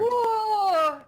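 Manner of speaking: loud, high drawn-out whoa; astonished. Real, not acted. Co-commentator reacts to a game-losing blunder, astonished. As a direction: cry out at a sudden blunder.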